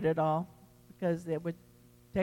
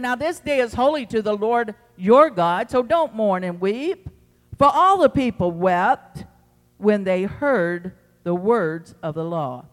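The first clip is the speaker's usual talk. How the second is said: proclaimed scripture; 6 dB louder than usual. Acted. Reading scripture forcefully.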